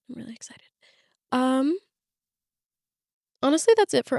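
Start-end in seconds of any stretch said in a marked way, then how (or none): 0.1-0.7 excited little whisper; breathy secret aside. Real, not acted.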